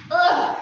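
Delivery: effortful grunt mid-exercise; straining grunt. Real, not acted. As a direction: push out a strained ugh. Straining through leg kicks.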